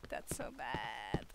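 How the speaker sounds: slow low whisper. Real, not acted. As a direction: breathe it out. Whispered aside.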